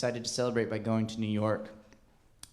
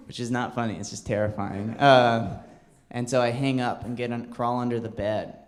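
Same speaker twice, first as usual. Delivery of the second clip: normal talking voice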